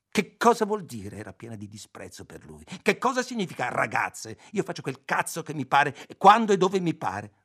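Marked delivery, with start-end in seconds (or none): 0.1-1.1 much higher and louder, full of contempt; scornful demand. A narrator's reading. Ayla scornful at Roby's words. 2.8-7.3 rising higher and louder toward the end; defiant anger. A narrator's reading. Ayla defiant, building anger.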